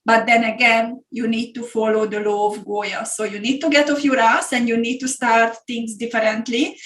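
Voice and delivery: normal talking voice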